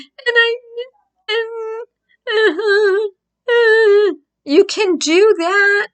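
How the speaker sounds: high wailing fake sob; fake crying voice. Shown as a demonstration. Showing viewers a fake cry, loud high wailing. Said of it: "get your voice to sound like that".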